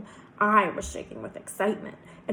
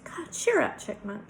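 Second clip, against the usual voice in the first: sweet Southern drawl. A narrator's reading. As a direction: cheer him up in a Southern lilt. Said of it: "speaks in a sweet southern drawl".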